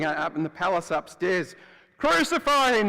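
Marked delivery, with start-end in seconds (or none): 2.0-2.9 loud, high mob yell. Retold or imitated. Crowd in the palace yelling for his death, loud high yell. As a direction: shout it like an angry crowd upstairs. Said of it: "they were yelling".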